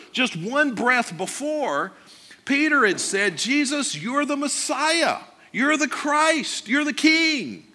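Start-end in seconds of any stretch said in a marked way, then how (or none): 3.4-7.6 eager praise. Retold or imitated. Peter's confession voiced in sermon.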